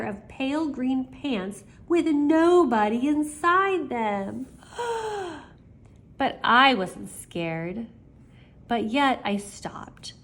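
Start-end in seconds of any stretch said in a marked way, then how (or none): 4.8-5.4 gasp. Acted. Scared by empty pants.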